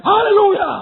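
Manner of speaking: high, loud burst of praise; sudden outburst. Retold or imitated. Preacher suddenly bursts out, bewildering onlookers, sudden outburst. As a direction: blurt out a jubilant yell. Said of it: "Tiba-tiba pikir".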